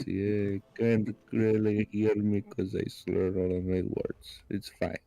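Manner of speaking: slurred, tipsy Russian accent; drunk Russian accent. Shown as a demonstration. Streamer showing friends how a Russian sounds, drunk Russian accent. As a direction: slur the words like a drunk Russian. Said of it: "A Russian generally sounds a bit more drunk".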